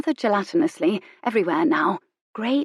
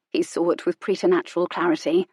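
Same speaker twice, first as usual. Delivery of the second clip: plain reading voice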